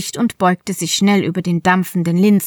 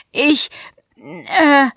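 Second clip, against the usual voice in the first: high, flustered hesitation; startled stammer; 5 semitones higher than usual. Acted. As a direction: caught off guard, stumbling for words. Kira stammers, caught off guard.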